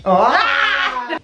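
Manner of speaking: high-pitched shriek; startled yelp. Real, not acted. Friend attempting a photo pose, startled yelp. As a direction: let out a sudden high yelp.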